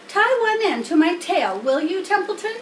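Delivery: a piglet impatient to try; eager pig. Acted. Wilbur asking Templeton, high eager pig.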